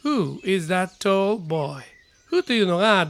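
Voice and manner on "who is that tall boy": very slow, every word clear; careful English model sentence; slow model reading. Shown as a demonstration. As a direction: read it slowly for students to copy. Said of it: "まず".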